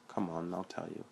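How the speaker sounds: soft sulky mumble; pouting. Acted. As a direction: say it softly, sulking. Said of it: "he said, pouting".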